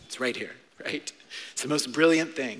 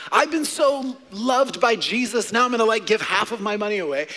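excited generosity; 5 semitones higher, 5 dB louder than usual. Acted. Acting out joyful giving.

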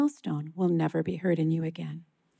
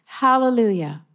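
louder read-out call of praise; restrained shout; 3 semitones higher, 6 dB louder than usual. A narrator's reading. Reader voicing a great multitude like thunder, shouting with restraint. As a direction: proclaim it loudly, just short of shouting.

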